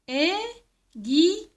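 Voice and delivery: "Aiguille" slowly in three separate beats; split into syllables. Shown as a demonstration. Teacher counting syllables with children, split into syllables. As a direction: break "Aiguille" apart as é, gui, ye. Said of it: "c'est trois syllabes".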